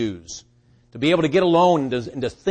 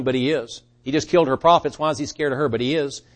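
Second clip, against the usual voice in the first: normal talking voice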